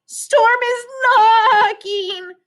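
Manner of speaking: high, slow, sung-out panicked cry; frightened cry. A narrator's reading. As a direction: wail it out in fear. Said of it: "the sheep cried".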